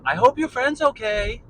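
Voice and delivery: a hint of a worried woman; lady's voice.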